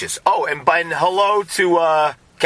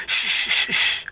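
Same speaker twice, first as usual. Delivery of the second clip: unvoiced shushing to hide a name. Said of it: "I like how you just go".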